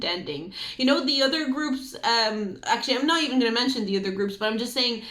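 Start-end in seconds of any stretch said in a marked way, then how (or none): none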